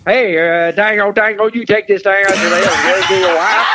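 rambling Southern mumble, words run together; Boomhauer. Retold or imitated. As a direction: imitate a babbling old Texan. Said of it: "he is Boomhauer from King of the Hill".

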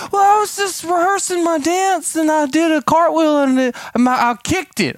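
put-on high, pleading child voice; whimpering little daughter. Daughter explaining her cartwheel to dad, childlike whine. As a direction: imitate a little girl explaining herself. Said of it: "she said".

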